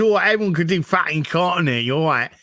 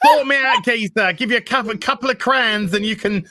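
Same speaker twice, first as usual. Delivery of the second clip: put-on high, cheeky Cockney street girl; Cockney accent; 6 semitones higher than usual. Shown as a demonstration. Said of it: "how one of those prostitutes would have sounded".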